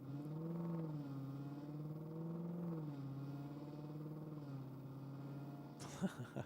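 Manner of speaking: long quiet laugh. Real, not acted. Long laugh after story.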